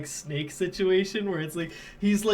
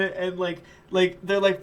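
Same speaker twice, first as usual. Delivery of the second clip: ordinary delivery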